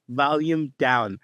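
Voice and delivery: very slow, deliberate words; mock voice command. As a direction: spell it out slowly for a machine. Acting out a voice command.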